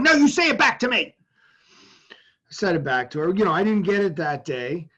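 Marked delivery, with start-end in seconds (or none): 0.0-1.1 higher, louder, quick firm order; insistent mom. Retold or imitated. Mother insists her son repeat her words back, loud and fast. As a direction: push him hard to repeat it, like a fierce mother.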